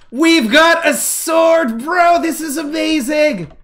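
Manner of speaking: high, drawn-out cheer; thrilled, high-pitched and slow; excited celebration. Real, not acted. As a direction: stretch the words high with glee. Celebrating a new sword.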